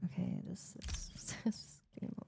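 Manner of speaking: breathy whispered stalling. Real, not acted. Streamer whispering to herself mid-opening. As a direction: whisper it under your breath. Laughing at own frustration.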